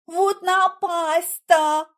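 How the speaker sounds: high, slow exclamation; distressed cry. A narrator's reading. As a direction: cry out in dismay, stretching it. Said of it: "закричала я".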